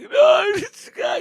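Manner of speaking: high anguished cry of protest; despairing kid. Retold or imitated. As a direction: wail like a crushed child.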